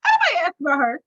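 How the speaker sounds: imitating an offended wife; sharp little mock retort; mock indignant. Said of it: "Monique said".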